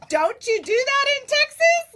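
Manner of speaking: high, loud, incredulous question; mock surprise. Retelling her reply to the market lady, high and loud. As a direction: act baffled that Texans don't wear boots. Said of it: "I'm like".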